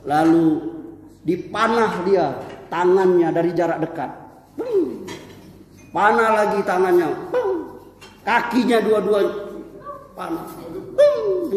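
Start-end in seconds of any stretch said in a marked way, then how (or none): 4.6-5.1 high-pitched "bung" arrow twang; short high vocal sound effect; imitated arrow sound. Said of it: "Bunyi suaranya". 7.3-7.8 high falling "bung"; short high vocal sound effect; imitated arrow sound. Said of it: "Bunyi suaranya". 11.0-11.5 very high falling "bung"; high-pitched vocal twang; imitated arrow sound. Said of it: "Bunyi suaranya".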